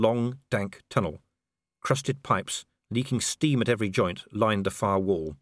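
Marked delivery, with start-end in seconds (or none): none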